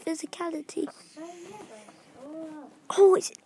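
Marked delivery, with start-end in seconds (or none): 3.0-3.3 loud, high surprised cry; excited exclaim. Real, not acted. Vlogger seeing an in-form card, loud excited cry. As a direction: blurt it out in delight.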